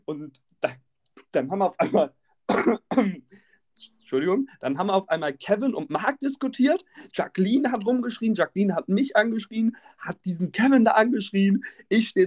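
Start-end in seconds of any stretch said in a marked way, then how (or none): none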